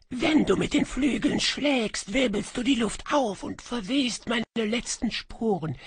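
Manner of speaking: high, ghostly, sighing echo voice; a lost spirit murmuring its lament from a kettle; high wistful whispery phantom; faint melancholy echo. A narrator's reading. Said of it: "Die Antwort war kaum hörbar".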